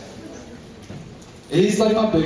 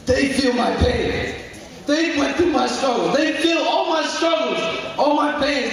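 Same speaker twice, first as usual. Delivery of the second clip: impassioned; 7 semitones higher than usual. Real, not acted. Impassioned team praise.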